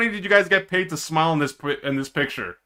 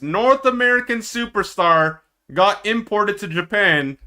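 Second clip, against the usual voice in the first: ordinary talk, nothing marked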